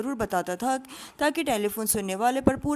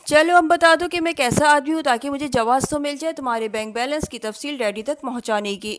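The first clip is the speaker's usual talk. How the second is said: usual speaking voice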